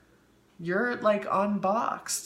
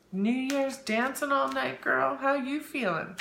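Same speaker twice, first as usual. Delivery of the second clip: high, loud, drawn-out hello; excited greeting; 8 semitones higher, 3 dB louder, slower than usual. Real, not acted. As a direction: sing out a big welcome. Greeting a viewer in chat.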